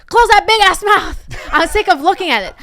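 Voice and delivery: imitating mom's exasperated high yell. Mom snapping at her crying three-year-old granddaughter, yelling. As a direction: yell it fast and high, fed up. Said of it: "Yelling at a child?".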